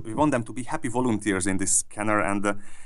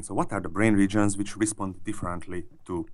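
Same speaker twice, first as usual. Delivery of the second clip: normal talking voice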